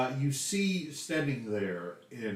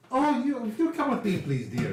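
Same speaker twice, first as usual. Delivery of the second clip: Lady Drenna; 8 semitones higher than usual. Acted. Hostess ushers a stray guest away, acted high and insistent. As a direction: start high and insistent, then ease down. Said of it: "You turn to see Lady Drenna".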